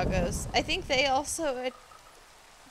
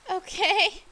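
usual speaking voice

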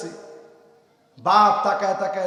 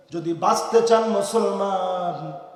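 subdued sermon chant; low intoned preaching; 3 semitones lower than usual. Real, not acted. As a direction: intone it low with drawn-out phrase endings.

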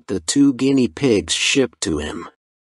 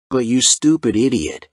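ordinary delivery

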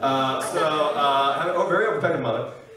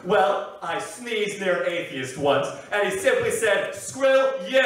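high, stretched joke-telling impression; John Mulaney; 5 semitones higher, slower than usual. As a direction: build the punchline higher in a parodied comic voice. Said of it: "I'm gonna simulate it for you".

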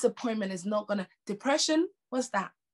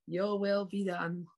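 sarcastic; slower than usual. Retold or imitated. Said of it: "I was being very sarcastic".

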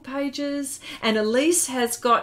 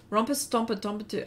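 ordinary delivery

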